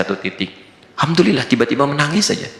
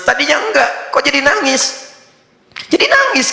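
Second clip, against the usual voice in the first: much higher puzzled exclaim; mock surprise. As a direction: act baffled at the crying child. Child suddenly cries during prayer.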